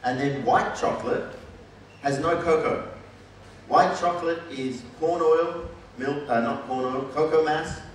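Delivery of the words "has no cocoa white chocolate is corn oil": normal talking voice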